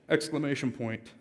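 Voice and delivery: ordinary delivery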